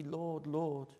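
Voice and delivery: ordinary talk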